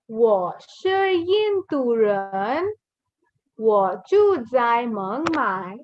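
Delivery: very slow, stretched Mandarin syllables; slow model reading. Shown as a demonstration. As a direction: model the phrase slowly for learners. Teacher models introduction pattern slowly.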